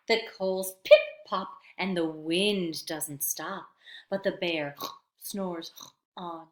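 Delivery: plain reading voice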